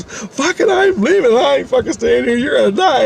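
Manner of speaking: high-pitched frantic refusal; tearful panic. Retold or imitated. Dawn refusing to stay with her coworker, tearful panic. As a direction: imitate her squealing in alarm. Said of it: "Dawn started fucking crying".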